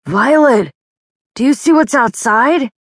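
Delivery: alarmed call. Acted. Noah alarmed by dragon roar.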